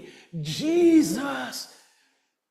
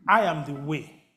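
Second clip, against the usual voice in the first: tender voice of the Lord; 7 semitones lower, 4 dB quieter than usual. Acted. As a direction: speak as God comforting gently. Voicing the Lord softly over music.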